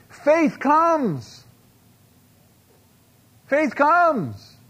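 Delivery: high, ringing preacher's call, repeated; ringing proclamation. Real, not acted. Pastor proclaiming faith to the congregation, high ringing call. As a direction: lift it up like an altar call.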